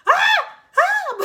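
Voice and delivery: short high piercing screams; loud shrieks in sudden bursts; acted-out scream of alarm; re-enacted scream. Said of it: "So I start screaming".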